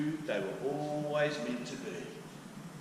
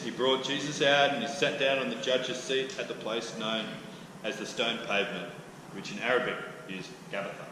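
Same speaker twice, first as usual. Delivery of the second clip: normal talking voice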